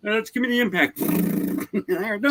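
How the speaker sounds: high-pitched mock mechanic; eager mechanic mimicry. Mechanic grabbing the impact for hand-tight fittings, high mocking voice. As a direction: play the overeager mechanic in a high voice.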